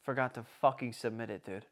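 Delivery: low, soft admission; quietly owning up to the blunder; deflated confession. Retold or imitated. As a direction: drop the voice for the letdown. Deflated punchline of his retold mishap.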